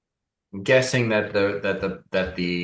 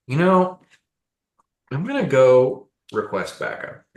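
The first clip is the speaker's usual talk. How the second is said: wary guard; 6 semitones higher, 5 dB louder than usual. Acted. Guard voiced by game master.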